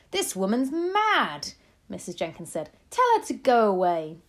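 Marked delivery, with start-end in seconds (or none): 0.1-1.5 high, loud, drawn-out indignation; slow, scandalised wife; outraged. A narrator's reading. Outraged wife insults grandmother. 2.9-4.2 loud, indignant wife; outraged demand. A narrator's reading. Outraged wife orders her gone.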